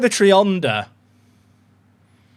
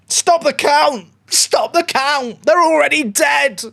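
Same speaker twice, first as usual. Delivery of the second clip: high, slow celebratory holler; triumphant shout; 10 semitones higher, 4 dB louder, slower than usual. Real, not acted. Streamer crowing to chat after a goal, triumphant shout. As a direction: call it over, loud and drawn out.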